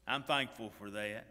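ordinary delivery

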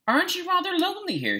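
put-on very high-pitched boy's call; high, restrained shouted question; mock shout. A narrator's reading. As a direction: call out high, without real volume. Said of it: "shouted Colin".

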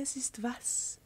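soft, unvoiced whisper; whispered question. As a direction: breathe the question. Pupil whispers back.

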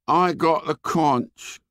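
put-on slow, bleak hurt boy. A narrator's reading. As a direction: flatly repeat it, hopeless. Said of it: "said Piggy bleakly".

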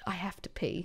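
very soft, low aside; hushed replay. Retold or imitated. Reliving the embarrassing library slip, hushed. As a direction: drop it way down, almost to nothing.

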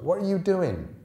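sharp high alarm; a girl startled by his turn; alarmed question. A narrator's reading. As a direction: jump up in alarm.

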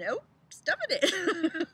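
high, slow, drawn-out mock voice; sing-song stuff it in. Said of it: "our body's like".

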